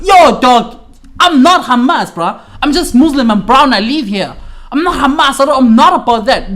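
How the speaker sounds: loud, high-pitched pleading denial; acted indignant protest.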